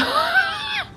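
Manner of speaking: short high-pitched giggle; a quick squeaky laugh. Real, not acted. Laughing at the friend's awkward question, a high giggle.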